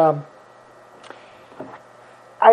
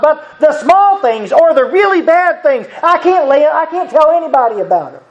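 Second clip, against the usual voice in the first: raised, earnest preaching voice; 6 semitones higher than usual. Acted. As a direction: lift it up and preach to the congregation. Preaches on confession.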